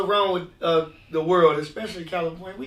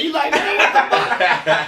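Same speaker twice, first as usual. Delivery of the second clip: laughing mock shock; 6 semitones higher, 4 dB louder, slower than usual. Laughing retelling of meeting big cellmate.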